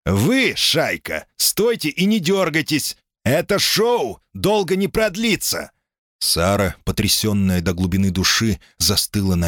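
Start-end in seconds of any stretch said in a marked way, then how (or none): 0.1-5.7 high, menacing thug's order; threatening. A narrator's reading. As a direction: threaten the crowd with contempt. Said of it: "с угрозой сказал".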